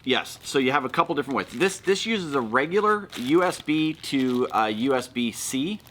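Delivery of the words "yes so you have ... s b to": usual speaking voice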